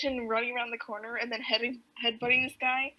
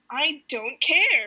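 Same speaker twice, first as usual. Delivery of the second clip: loud, drawn-out mock protest; slow, hammed-up loud retort; imitated irate insistence; 3 semitones higher, 8 dB louder, much slower than usual. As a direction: stretch each word out forcefully, play-acting an irate caller. Acts out Haddock arguing on phone.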